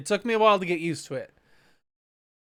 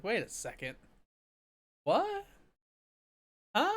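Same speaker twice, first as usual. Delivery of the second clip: confused reaction; 6 semitones higher, 5 dB quieter than usual. Retold or imitated. Host recalling first seeing the poster, confused. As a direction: replay a baffled double take. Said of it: "I was really confused".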